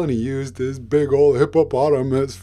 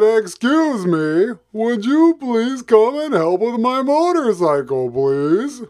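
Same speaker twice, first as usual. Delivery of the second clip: even louder and higher, slow pleading shout; louder call; 9 semitones higher, 7 dB louder, slower than usual. Acted. Hippo shouting again louder into the silent shop, pleading. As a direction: call out harder, nobody answered. Calling louder second time.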